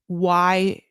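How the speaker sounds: ordinary talk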